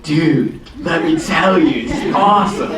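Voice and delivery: put-on thick West Coast dude accent; Reed. Imitating his buddy telling a story, thick West Coast accent. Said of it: "thick West Coast accent".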